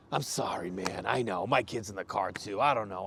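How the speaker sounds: low, soft, defeated mumbled sorry; retold sheepish apology; deflated apology. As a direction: drop the shoulders and give in. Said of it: "he just drops his shoulder".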